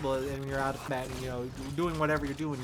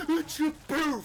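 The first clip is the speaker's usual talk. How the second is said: much higher, slow, squeaky teasing voice; sneaky ninja taunt; 13 semitones higher, slower than usual. Retold or imitated. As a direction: pop out and tease them, squeaky and slow. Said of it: "you can just be like".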